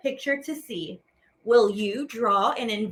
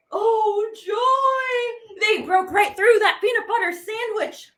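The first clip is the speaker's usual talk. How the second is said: much higher and louder, fast joyful shout; joyful cry; 8 semitones higher, 3 dB louder than usual. Acted. Narrator shouts the crowd's joy at the breakthrough. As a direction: cheer it out with delight.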